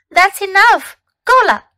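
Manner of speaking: sharp, loud, high "enough"; scolding. Shown as a demonstration. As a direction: stop the kids at once. Teacher drills a scolding phrase.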